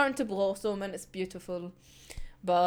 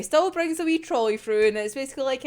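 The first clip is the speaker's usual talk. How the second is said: usual speaking voice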